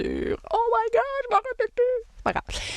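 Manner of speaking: high-pitched comic panic; playful dismay. Real, not acted. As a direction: squeal at forgetting, half-joking. Suddenly blanks on the episode.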